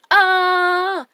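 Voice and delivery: high, loud scream of fright; frightened yell. A narrator's reading. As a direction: shriek as the bully bolts in panic. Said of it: "yelled the bully".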